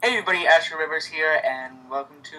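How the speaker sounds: ordinary delivery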